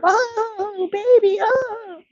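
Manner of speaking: put-on high, slow doting coo at a baby; cooing baby talk. Lady cooing at her baby.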